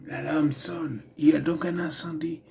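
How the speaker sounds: whispered line in a put-on African accent; mock African accent. Guard spotting a fire, whispered in a mock African accent. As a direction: breathe it out in the hero's mock accent. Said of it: "donc je refaisais le personnage".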